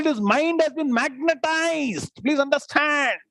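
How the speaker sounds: ordinary talk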